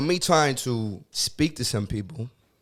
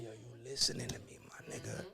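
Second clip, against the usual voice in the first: whisper; 18 dB quieter than usual. Retold or imitated. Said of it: "he's like".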